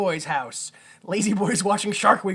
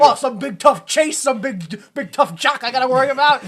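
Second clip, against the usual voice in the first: louder, higher hammed-up tough-guy cop voice, laughing through it; Robert De Niro; 7 semitones higher, 7 dB louder than usual. Imitating the cop griping about his tough partner, hammed up and laughing. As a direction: ham up a tough cop sneering at a big tough jock. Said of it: "Flawless Robert De Niro I'm doing right now".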